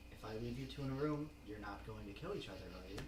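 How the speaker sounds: normal talking voice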